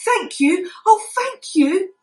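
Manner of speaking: high, slow, grateful thank you; grateful purr. A narrator's reading. Kitten thanking the fairy doll, lightly purring. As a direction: purr your thanks, stretched out. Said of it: "purred Winkle".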